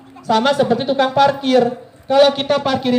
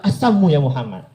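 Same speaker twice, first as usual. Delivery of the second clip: low sneering curse; 7 semitones lower than usual. Retold or imitated. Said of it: "langsung dibilang".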